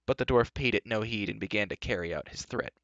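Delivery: ordinary delivery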